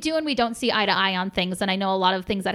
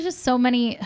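ordinary delivery

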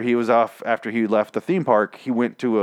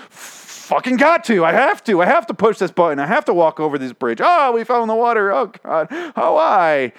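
hammed-up moaning of a zombie that just has to cross; mock zombie compulsion; 11 semitones higher, 4 dB louder than usual. Zombies drawn to the bridge hole, mock desperate whine.

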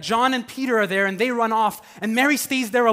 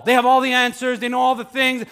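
ordinary talk

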